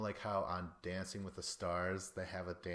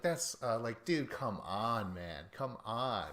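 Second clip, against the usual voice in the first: usual speaking voice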